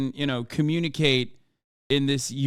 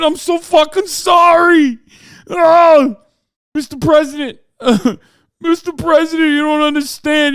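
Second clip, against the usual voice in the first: high, loud blubbering New York cop; shout-sob like a thick New York cop; New York cop accent; 12 semitones higher, 10 dB louder than usual. Pretend. Streamer imagines weeping New York cop arresting Trump, loud New York accent. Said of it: "the New York Hogs wouldn't have that kind of accent".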